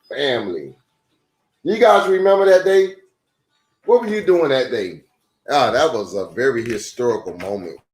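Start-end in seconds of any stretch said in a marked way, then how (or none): none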